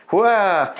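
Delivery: surprised. Real, not acted. Sudden startled reaction.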